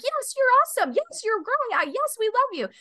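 high, exultant angel chorus; angels' praise. Retold or imitated. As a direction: sing out worship like a host of angels. Angels worshipping in the vision.

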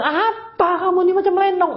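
high, sneering jab about the lipstick; mocking husband. Husband mocking his wife's makeup, high and sneering. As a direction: tease her like a blunt husband. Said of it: "Jangan kata".

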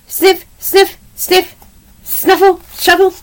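short, high, separated sniff words; sniffing noises. A narrator's reading. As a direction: voice the snuffling as little sound effects. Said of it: "she heard a noise".

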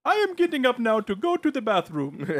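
put-on Sebastian-like drunk voice. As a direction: declare it in a mock cartoon-crab accent. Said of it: "certain level inebriated where I just start".

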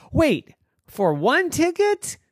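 high, slow, disbelieving question; surprised. Acted. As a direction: stretch it out in disbelief. Girls surprised at price.

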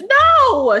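high, loud, incredulous no; emphatic no. Real, not acted. Correcting a guess about the neighbor's age, loud high no. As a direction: blurt the no out in disbelief. Answering whether neighbor is older.

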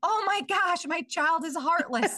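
high, hushed mock shock; mock horrified parent. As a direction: gasp it out in mock horror, pitched high. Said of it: "And then you are like".

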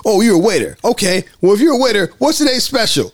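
high, skeptical challenge; mock interrogation. Cops quizzing a supposed waiter, sceptical. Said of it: "And they're like".

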